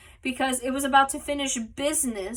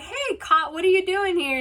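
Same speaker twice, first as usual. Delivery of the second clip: higher, bright mom voice calling out; imitating Jerry's mom; 7 semitones higher, 3 dB louder than usual. Jerry's mom greeting Cot at the door, pitched-up mock voice. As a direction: play the surprised neighbor mom, pitched up.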